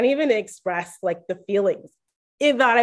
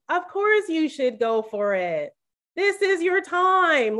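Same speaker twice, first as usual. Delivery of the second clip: forced cheer, high and slow on time; fake-bright encouragement, pitch jumping up; angrily encouraging; 9 semitones higher, slower than usual. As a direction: cheer her on through clenched jealousy. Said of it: "I was angrily encouraging her".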